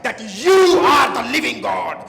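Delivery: very loud, stretched shout of praise; exalted cry. Real, not acted. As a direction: burst out in worship, holding the words long. Climax of fervent prayer. (ज़ोर से पुकारकर स्तुति)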